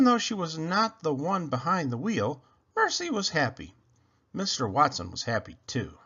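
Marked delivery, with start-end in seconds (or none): none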